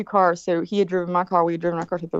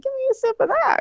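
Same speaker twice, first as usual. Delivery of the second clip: much higher-pitched insistent plea; eager repeated request. Retold or imitated. Partygoer repeating the request to strangers, high and insistent.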